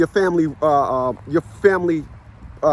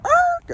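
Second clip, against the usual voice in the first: dog's yelp. Retold or imitated. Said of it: "and she said".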